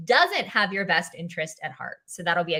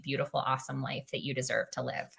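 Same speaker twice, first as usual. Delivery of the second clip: ordinary talk, nothing marked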